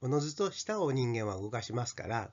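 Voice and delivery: ordinary talk, nothing marked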